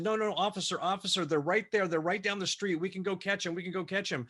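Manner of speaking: high pitched, urgent begging to the officer; frantic high plea, they're getting away; panicked pleading. Retold or imitated. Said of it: "in a panic state".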